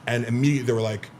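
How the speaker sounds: ordinary talk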